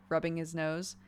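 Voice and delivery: ordinary talk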